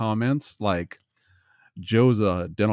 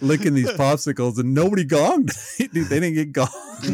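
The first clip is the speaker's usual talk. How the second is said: excited; 6 semitones higher than usual. Real, not acted. Amazed telling of Gong Show clip.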